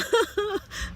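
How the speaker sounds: very high laugh breaking through tears; a burst of laughter mid-cry; tearful laugh. Real, not acted. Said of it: "I am crying now".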